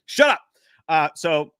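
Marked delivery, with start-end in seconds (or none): 0.1-0.4 short high, loud retort; mock snap. Host to teasing viewers, mock snap. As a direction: cut the hecklers off loudly, in play.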